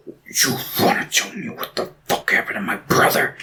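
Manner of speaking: hushed, half-whispered interrogation; mock menace. Faramir confronting hobbits about his brother, hushed mock menace. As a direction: whisper the question like a threat.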